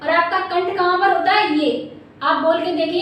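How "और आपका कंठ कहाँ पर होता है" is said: ordinary talk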